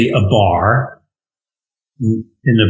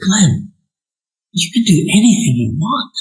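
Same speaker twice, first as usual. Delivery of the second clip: higher, gently imitating his encouraging mother; mom's encouragement; 7 semitones higher than usual. Son recalling mother telling him he can do anything, fond higher voice. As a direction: fondly echo mom's pep talk. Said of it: "and she would say".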